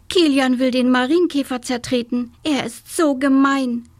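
high, indignant little sister; heated childish complaint; scolding. A narrator's reading. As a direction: tell on the boy in outrage. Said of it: "schimpfte die kleine Schwester".

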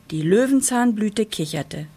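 plain reading voice

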